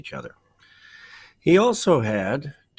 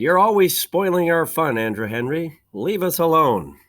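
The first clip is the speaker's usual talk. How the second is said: mild cry; 6 semitones higher, 3 dB louder than usual. Acted. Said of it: "They cried".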